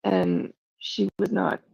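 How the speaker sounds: usual speaking voice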